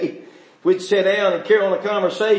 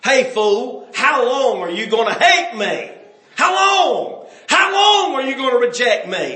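louder, pitch leaping up on HOW LONG; higher, louder plea, peaking on the second how long; pleading rebuke; 6 semitones higher, 4 dB louder than usual. Acted. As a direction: cry it out like wisdom calling the fool. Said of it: "That's what wisdom is saying".

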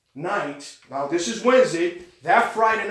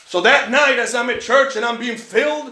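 ordinary delivery

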